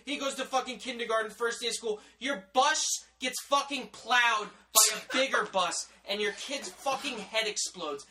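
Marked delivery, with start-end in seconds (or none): 2.2-8.1 slow, loud, vengeful punch on each word; slow down and pound out the curse; angry rant climax. Pretend. Wishing disaster on a bad customer, slow angry rant.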